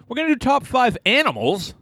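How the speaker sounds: high, bright announcement; excited. Real, not acted. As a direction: announce it with big enthusiasm.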